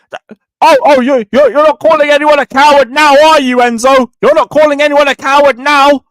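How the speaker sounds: loud, high-pitched jeering; mocking taunt. Real, not acted. Fan crowing over critics of Enzo, loud mocking taunt. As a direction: throw it back in their face, shouting.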